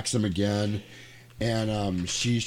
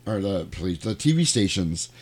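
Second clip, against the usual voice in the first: normal talking voice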